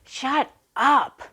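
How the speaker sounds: sudden angry shout, acted out; angry yell. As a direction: yell it like an irritated neighbour. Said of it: "yelled in a very angry tone".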